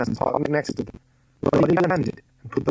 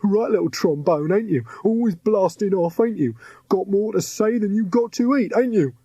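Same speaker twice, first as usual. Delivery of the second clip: higher mocking thug voice; taunting henchman; 8 semitones higher than usual. A narrator's reading. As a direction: jeer at the captive girl.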